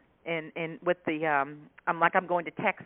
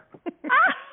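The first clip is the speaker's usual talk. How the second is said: high squealing laugh; 6 dB louder than usual. Real, not acted. Telling her funny story.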